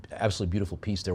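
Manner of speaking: ordinary talk, nothing marked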